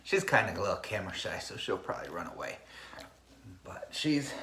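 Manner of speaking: quiet aside. Real, not acted. Aside about shy dog.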